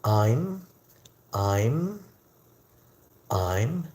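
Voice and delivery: "I'm" drawn out with a rising glide; pronunciation demo. Shown as a demonstration. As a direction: stretch "I'm" slowly upward, pausing between repeats. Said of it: "it's pronounced like".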